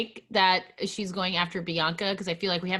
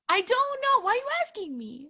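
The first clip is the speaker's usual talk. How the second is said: high squeaky I-don't-know, playfully defensive; squeaky protest; 13 semitones higher than usual. Acted. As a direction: throw it up high like you're innocent. Mock defensive answer to cohost.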